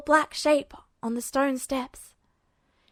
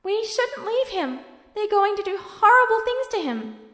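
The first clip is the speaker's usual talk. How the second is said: a little desperately; 8 semitones higher, 4 dB louder than usual. Acted. Alphonse begs Edward not to leave Duo, higher and desperate. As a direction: beg him not to abandon a friend. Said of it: "a little desperately".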